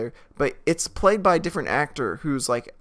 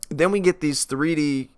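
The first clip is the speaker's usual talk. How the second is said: ordinary delivery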